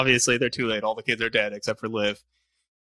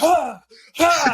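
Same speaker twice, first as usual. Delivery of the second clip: mock scream; 6 dB louder than usual. Nicolas Cage thrashing, retold scream.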